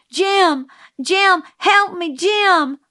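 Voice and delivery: high, loud panicked calls; frightened cry for help. A narrator's reading. Scout calling Jim for help while falling, panicked yell. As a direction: yell desperately for rescue.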